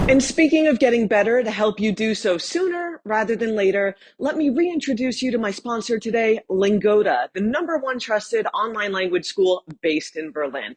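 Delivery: light ad-read voice; sponsor read. Acted. Vlogger introducing her sponsor to viewers, light ad-read. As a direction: slide into the sponsor segment.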